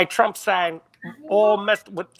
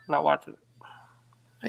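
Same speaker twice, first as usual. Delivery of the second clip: whispering; 5 semitones lower, 5 dB quieter, much slower than usual. Real, not acted. Refusing to watch dog scene.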